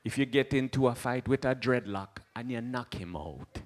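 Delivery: a hint of a calm Rasta elder teaching; lightly voiced Rasta sage. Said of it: "hit you with one like".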